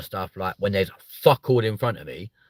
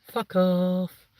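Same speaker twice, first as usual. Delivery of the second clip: mock smug dismissal; 9 semitones higher than usual. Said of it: "And you're like".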